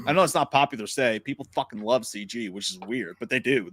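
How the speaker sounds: ordinary delivery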